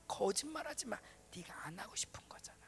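whispered, intimate reproof; imitated inner voice, hushed and fading; God's quiet rebuke. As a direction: expose the excuse under your breath. God answering her excuse in her heart.

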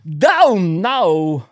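baffled wonder in an odd halting cadence; Christopher Walken. Acted. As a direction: draw out each word oddly. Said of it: "Christopher Walken sees an amazing magic trick".

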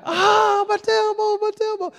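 high whiny mock wail; imitating crying boy. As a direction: whimper like a hurt little kid. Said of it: "He's laying in the arena crying".

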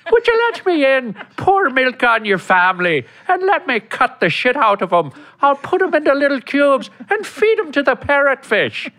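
high Irish leprechaun voice; menacing high leprechaun; Irish leprechaun accent. Retold or imitated. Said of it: "we got Lucky".

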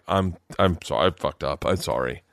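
low, halting imitation of a relenting wife; contrite apology. Husband voices wife owning up to him, contrite and low. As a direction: let the pitch sink as you admit fault.

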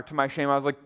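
usual speaking voice

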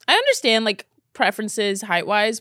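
normal talking voice

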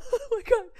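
laughing. Real, not acted. Reacting to backwards word.

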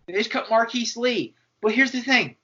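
ordinary talk

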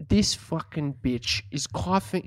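normal talking voice

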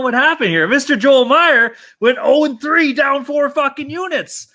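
hammed-up disbelief at the loss; mock outrage. Host roasting Joel Meyer's losing picks, hammed-up outrage.

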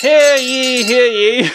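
loud, high town crier call. Acted. Proclaiming a declaration.

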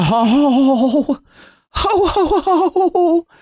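high jolly Santa laugh. Pretend. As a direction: belt out a jolly Noel Baba ho ho ho. Santa-style ho ho ho.

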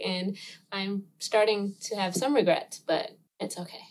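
ordinary talk

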